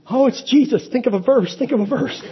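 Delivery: mock paranoid panic. Follower who sees Jesus coming, mock panicked. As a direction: play the nervous follower scrambling, for laughs. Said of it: "How paranoid would you be?".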